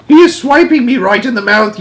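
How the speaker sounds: high giant's shout of complaint; outraged yell. Acted. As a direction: yell as if just struck. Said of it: "yelled the beat, meat dripper".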